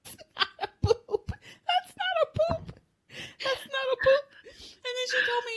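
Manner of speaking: tearful laughter. Retold or imitated. Imitating grandmother rejecting child's excuse, tearful laughter. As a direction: quote her while cracking up helplessly. Breaking up repeating grandmother's denial.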